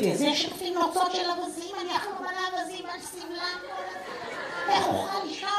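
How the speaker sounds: high, soft mock-precious voice; fussy vegan date. Vegan date refusing a feather pillow, high soft mock voice. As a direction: imitate a delicate vegan, high and fading.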